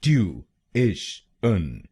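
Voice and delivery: Tuition stretched slowly, "tyoo... ish... un"; slow syllable-by-syllable. Shown as a demonstration. Teacher slowing the word down so learners can follow. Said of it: "तो बनेगा".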